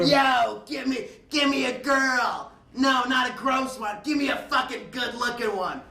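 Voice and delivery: high, slowed shouting into the phone; brash yelling order. Retold or imitated. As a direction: boss the escort line around. Hack calling escort service, demanding.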